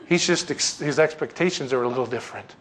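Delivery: ordinary delivery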